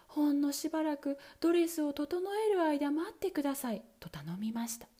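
ordinary delivery

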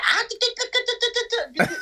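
high-pitched gibberish, laughing, imitating her Japanese promo; mock Japanese promo. Mocking Asuka's Japanese promo to a friend, in high gibberish. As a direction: rattle off fake Japanese syllables, way up high. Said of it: "She said".

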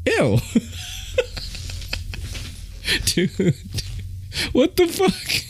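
high squeaky laughing through words; laughing disgust. Real, not acted. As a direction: crack up while saying ew. Disgusted laughter retelling snot.

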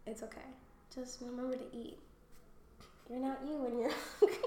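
put-on soft boyfriend calming a crying partner; mock soothing boyfriend. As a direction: comfort her gently, almost hushed. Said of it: "he's like".

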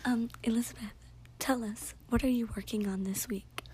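mock ASMR question whispered; playful ASMR interviewer whisper. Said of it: "A S M R".

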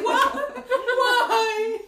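high, loud and laughing; shocked disbelief. Retold or imitated. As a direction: squeal it out in total shock. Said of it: "And she's like".

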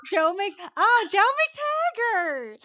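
high, loud, drawn-out name; mock theatrical exclamation. Podcast host playfully calling to Joe McTaggart, hammed-up cry. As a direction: hail him grandly, stretching the name.

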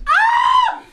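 very high-pitched shriek of laughter; real high laughing scream. Real, not acted. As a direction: squeal out loud, cracking up at an outrageous joke. Uproar over an outrageous joke.

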